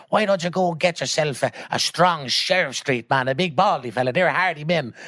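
high-pitched acted old Traveller woman; great granny's voice. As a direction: goad them on as the outspoken granny. Retold great granny's bawdy advice.

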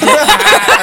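high shrieking laugh; loud burst of real laughter. Real, not acted. Laughing at rich and stupid.

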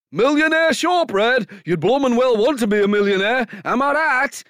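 high, loud northern comic voice; Peter Kay impression. Fictional Pete moaning about shortbread prices, in a loud comic voice. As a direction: ham up a Peter Kay bit. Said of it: "channeled Peter Kay in the mid-nineties".

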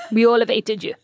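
hammed-up French accent, amused. French staff to their old boss, mock French accent. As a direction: mimic the French staff, half laughing. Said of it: "amazing French accents".

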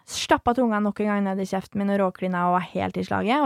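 usual speaking voice

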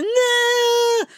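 very high, drawn-out screamed refusal; mock child's shriek. Mother imitating her son refusing her hand, high screamed refusal. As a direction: shriek it like a stubborn toddler. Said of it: "Så stod han och skrek".

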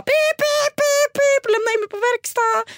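very high squeaky car alarm voice; beeping car voice. Retold or imitated. Car beeping at her after a bump, very high.

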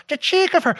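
high-pitched imitation of an outraged commenter; mock indignation. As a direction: put on a little affronted outrage.

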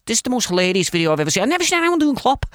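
high, fast put-on voice; Jamie Carragher's voice. Impressionist reading Jamie Carragher's tweet in his voice, high and fast. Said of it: "I don't know why I'm doing his voice".

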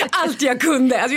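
high, loud and laughing. Real, not acted. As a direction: laugh at how hard you tried. Laughs at her own performance. (skrattande, högt och ivrigt)

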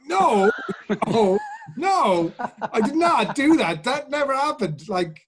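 high, loud panicked protest; father yelps his denial to the accusing son; mock outraged denial. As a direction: deny it frantically, voice shooting up. Denying being hit, escalating.

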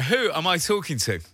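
normal talking voice

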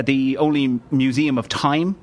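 ordinary talk, nothing marked

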